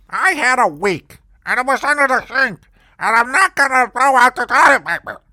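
high, loud put-on old landlady, R as W. Landlady justifying baking wet toilet paper, high put-on voice. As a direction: squeak it out like the stingy landlady. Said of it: "she really did talk like that".

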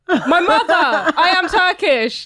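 high, slow, emphatic spelling-out; mock exasperation. Telling the shop woman she is Turkish, mock exasperated. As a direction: state the obvious with hammed-up disbelief. Exasperated reply to clueless stranger.

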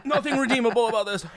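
laughing through the burn; pained laugh. Real, not acted. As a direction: laugh in real pain.

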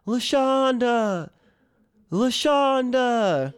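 high, slow drawn-out call; long calling-out of a name. Retold or imitated. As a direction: call a lost cat across the yard. Calling for the lost cat.

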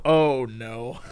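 low, soft sinking reaction; quiet dismay. Acted. Hearing the conspiracy rant, quiet mock dismay. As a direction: let it sink out quietly.

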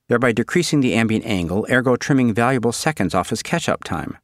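plain reading voice

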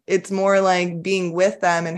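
usual speaking voice

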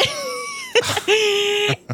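high shriek of laughter; high laughing squeal. Acted.

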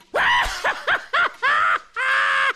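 high, shrieking laughter; screaming laugh. Shown as a demonstration. Host illustrating laugh types, screaming laugh. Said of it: "Then there's the screamer".

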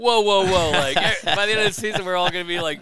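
high, loud exclaimed whoa; hammed-up shock at a teammate's request; mock alarm.

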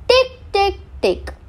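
high, crisp ticks; ticking sound. A narrator's reading. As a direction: tap out a tiny hammer. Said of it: "a sharp sound".

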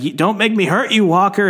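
high-pitched mock warning; retold threat, pitched well up; acted threat. Acted threat while being held back.

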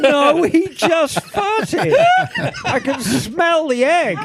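laughing through the words. Real, not acted. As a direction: giggle while saying it. Laughing about fart.

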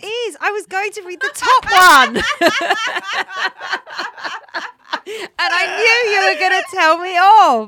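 high laughing protest. Real, not acted. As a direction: giggle through the words. Laughing while caught out.

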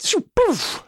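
high vocal whoosh and pop; bolt sound effect. Acted. GM voicing the missed crossbow bolt, high whoosh. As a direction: make the crossbow bolt sound with your mouth.

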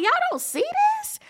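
high, fast incredulous squeal; mock disbelief at the senators; disbelieving y'all. Watching the hearing, she voices her stunned disbelief.

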